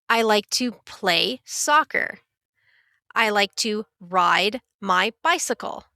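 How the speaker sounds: normal talking voice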